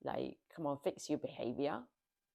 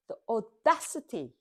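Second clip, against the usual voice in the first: indignant; 5 semitones higher, 8 dB louder than usual. Real, not acted. Outraged at the friend.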